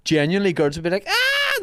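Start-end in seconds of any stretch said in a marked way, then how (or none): 1.1-1.6 very high imitated girlish scream; mock excited squeal. Girls thinking they won tickets, imitated high squeal. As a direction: squeal as if you've just won.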